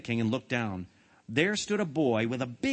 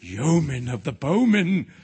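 slow, louder chorus of lords; echoing crowd; 3 semitones higher, 7 dB louder, slower than usual. A narrator's reading. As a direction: echo it like a whole awed court. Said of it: "echoed all the lords and noblemen".

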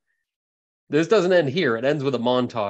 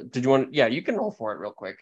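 ordinary talk, nothing marked

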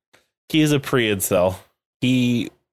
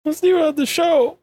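high pretend-tearful echo; hammed-up weepy voice, way up in pitch; mock crying. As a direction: whine it like a sobbing crybaby. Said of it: "[name]'s crying".

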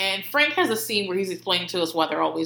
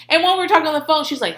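ordinary talk

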